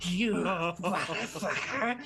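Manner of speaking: high, brash goblin voice; cocky goblin Ripper. Acted. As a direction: boast with a laugh. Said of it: "he's like".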